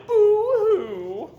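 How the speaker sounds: a mocking fake sob; mock boo-hoo cry. Grinch mimicking the crying Whos in a mock high wail. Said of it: "will all cry".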